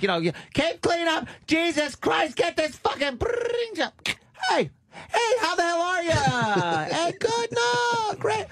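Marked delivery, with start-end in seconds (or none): 0.5-3.9 high hammed-up rant; mock ranting mother. Mother yelling at kids as phone rings, high mock rant. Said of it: "she'd be like". 4.5-8.4 very high sweet phone voice; happy mom on the phone. Retold or imitated. Mother answering phone, suddenly very high and cheerful. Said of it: "you'd hear Happy Mom".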